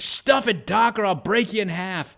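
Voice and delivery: lightly voiced high menacing threat; threatening tough guy. Caller threatening the radio host, lightly acted menace. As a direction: growl the threat, half joking. Retold angry radio confrontation.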